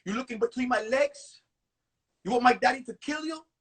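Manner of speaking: imitated Cuban girl making a threat; Cuban accent. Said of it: "In her Cuban accent".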